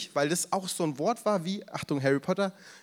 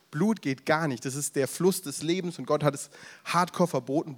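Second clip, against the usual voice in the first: ordinary delivery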